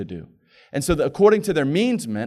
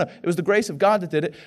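usual speaking voice

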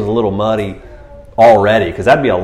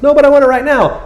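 high, loud petulant protest; impatient mock whine; 5 dB louder than usual. Impatient follower objecting to instructions, high mock whine. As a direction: whine it like a demanding child.

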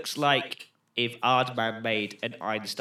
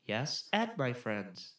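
slow, softer, wooden reply; flat film boyfriend; 3 semitones lower, 8 dB quieter, slower than usual. Acted. Co-host as boyfriend answering girlfriend, wooden. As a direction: read it like a bad actor. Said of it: "that's exactly how they talk".

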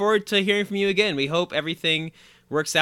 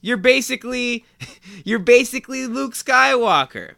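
mock tempter; 8 semitones higher, 4 dB louder than usual. Continues tempting voice.

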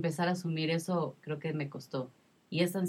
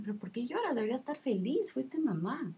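others quoted; 8 semitones higher than usual. Retold or imitated. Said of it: "me decían".

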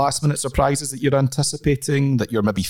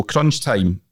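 normal talking voice

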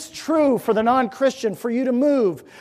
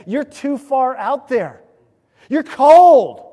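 raised voice building to a loud "cold"; loud, forceful rebuke in God's words; urgent summons; 5 semitones higher, 12 dB louder, slower than usual. Acted. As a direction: call them out from the pulpit, louder and higher. Preacher voices God's summons to listeners.

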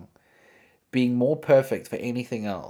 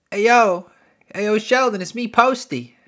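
high, louder goofy bro voice; a cartoonish caricature of a friendly rapper; 10 semitones higher, 5 dB louder than usual. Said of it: "I know he doesn't talk like that".

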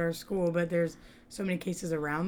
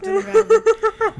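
slow, laughing and louder; 13 semitones higher, 10 dB louder, slower than usual. Real, not acted. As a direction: giggle through the line. Laughing at her own story.

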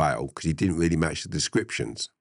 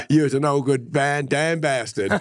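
high, loud, through laughter; laughing while quoting; 10 semitones higher, 4 dB louder than usual. Real, not acted. Quoting John Wayne in True Grit, laughing hard. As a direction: squeeze the film line out while cracking up.

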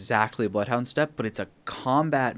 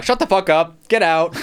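louder, higher joking yell; fake-furious, throwing him out in jest; mock outrage; 7 semitones higher, 9 dB louder than usual.

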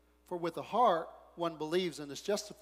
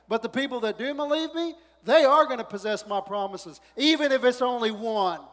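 louder, higher preaching rising in intensity; preaching at full force; 6 semitones higher, 9 dB louder than usual. Acted. Preacher stirring the congregation, building to full force. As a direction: drive the promise home to the church.

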